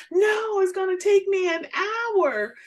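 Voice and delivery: high, insistent imitation of mom; protesting mother. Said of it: "she said".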